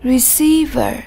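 "Receiver" with the stress on "cei"; second-syllable stress. Shown as a demonstration. Teacher models "Receiver" for the class, stressing its second syllable. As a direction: lean on the middle syllable of "Receiver". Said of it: "Nhấn âm tiết số hai".

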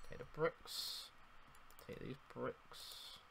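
whispering to himself; whispered. Real, not acted. Gamer whispering to himself while grabbing bricks, whispered. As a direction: whisper it under your breath. Quietly collecting blocks.

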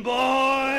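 loud, high, long-held boing; cartoon spring boing. Zebedee springs into the story, loud high boing. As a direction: imitate a bouncing spring at full voice.

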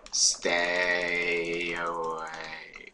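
stretched-out, droning warning to stay back; very slow, sustained words; drawn-out warning. Real, not acted. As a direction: hold each word very long. Warding off skeletons.

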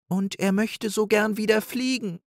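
high, small timid voice; shy little doe. A narrator's reading. Little Rike speaks from behind her sister, high and shy. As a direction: peep out bravely from behind.

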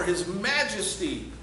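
ordinary talk

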